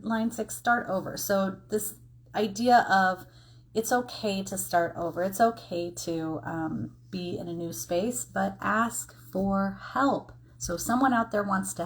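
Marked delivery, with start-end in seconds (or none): none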